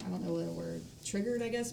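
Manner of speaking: ordinary talk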